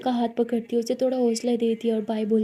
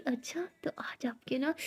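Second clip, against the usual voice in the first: whispered tease; 11 dB quieter than usual. Acted. Teasing whisper to Tara.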